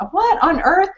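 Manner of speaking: high, incredulous what on earth; puzzled disbelief at her sister; baffled. Retold or imitated. Sister's strange suggestion leaves her baffled, high and incredulous.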